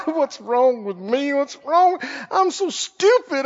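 whiny, drawn-out mock despair; mock self-pity. Preacher imitating a believer who messed up again, mock self-pitying wail. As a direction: wail it like someone wallowing in failure. Acting out self-condemnation after failing.

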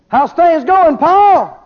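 high, loud call-out question; hearty hailing. Acted. Preacher plays someone asking Paul how things are going, hailing.

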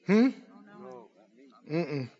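ordinary talk, nothing marked

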